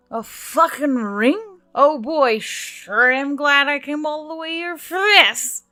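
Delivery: loud, high, slow sarcastic drawl; mock the worthless ring, loud and drunk; drunken sarcasm. Emily sneers at the ring, loud drunken sarcasm.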